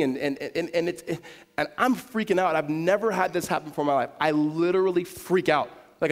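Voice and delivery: ordinary delivery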